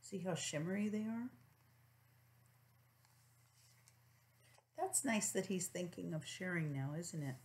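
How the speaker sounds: gentle hushed comment; soft aside. Real, not acted. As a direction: quietly point out the picture. Aside to child.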